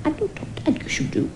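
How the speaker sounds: breathy whisper to himself; whispering as if to an imaginary friend; whispered aside. Acted. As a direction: drop to a secret whisper.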